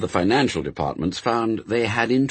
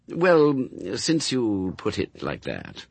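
plain reading voice

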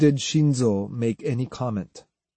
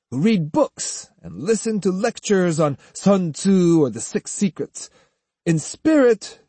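taunting lecture in a higher, lively voice; boastful swordsman; 6 semitones higher than usual. Acted. Swordsman boasting to the beaten military students, swaggering. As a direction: lord it over them, relishing every point.

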